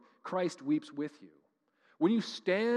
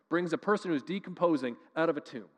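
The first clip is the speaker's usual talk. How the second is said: ordinary delivery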